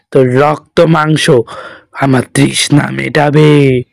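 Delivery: low, menacing spectral growl; a hungry spirit savouring its kill; threatening ghost. Acted. Ghost threatens to devour old Ganesh, low and menacing.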